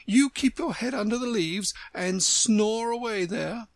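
high storybook flower tirade; scolding Tiger Lily. A narrator's reading. Tiger Lily scolding the Violet, scornful.

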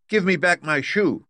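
high, loud demand; angry girl. A narrator's reading. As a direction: snap it out as an angry little girl. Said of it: "grew angry and said to the witch".

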